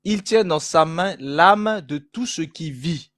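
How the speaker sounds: slow, solemn Bible reading; scripture reading. Acted. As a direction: read the verse slowly and formally. Reads Bible verse aloud.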